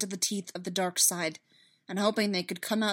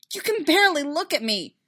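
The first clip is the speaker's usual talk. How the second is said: high, loud reproach; heated, wounded protest; hurt accusation; 8 semitones higher, 9 dB louder than usual. Acted. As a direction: throw it at her, upset. Anakin accusing Padme.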